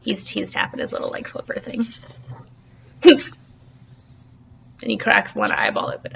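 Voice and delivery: whispered aside. A narrator's reading. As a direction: breathe it, barely voiced.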